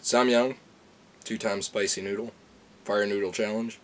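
ordinary talk

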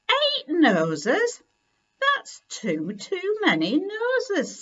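high, slow, astonished. Acted. Kipper counting toys, astonished at eight noses. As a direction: jump up in disbelief at the count.

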